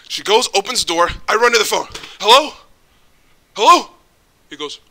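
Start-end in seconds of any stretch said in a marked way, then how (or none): 2.2-3.9 loud, high urgent calls; urgent hello. Retold or imitated. Grabbing the phone after running, loud urgent hello. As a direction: call out desperately into the phone.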